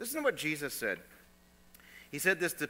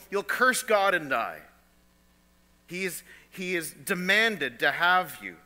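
louder and louder, driving the warning home; preaching at full force; 5 dB louder than usual. Real, not acted. Preacher warning the congregation about the devil, loud and rising. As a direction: build it up like a fiery preacher.

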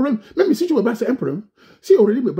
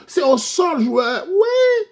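boastful shout; 5 dB louder, much slower than usual. Acted. Boasting about his title.

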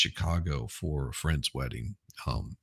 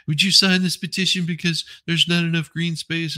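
much higher, louder pleading activist voice; mock whiny activist; 10 semitones higher, 4 dB louder than usual. Imitating the activist asking for a signature, mock whiny. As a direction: whine it like an eager kid activist. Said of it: "And it's just like".

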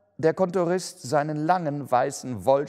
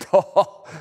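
burst of laughter; 8 semitones higher, 12 dB louder than usual. A narrator's reading. Clerk applauds, laugh.

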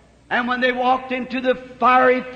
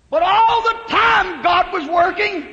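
preaching shout; 6 semitones higher, 3 dB louder than usual. Real, not acted. Preacher stresses God's hidden work.

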